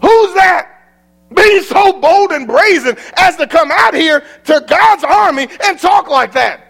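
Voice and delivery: loud, high, outraged challenge; indignant shout. Acted. David shouting at the giant in outrage. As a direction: call out the giant, raising the voice.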